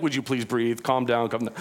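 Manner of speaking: put-on soft, low pleading to a screaming baby; lightly voiced worn-out parent calming baby; soothing parent. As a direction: hush the child gently. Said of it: "and we're like".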